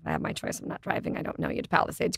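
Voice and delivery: breathy whisper, voice off. Retold or imitated. Retelling her text to Scott in a whisper. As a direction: whisper it like a secret aside.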